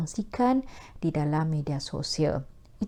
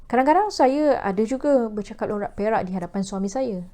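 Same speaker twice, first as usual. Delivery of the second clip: ordinary delivery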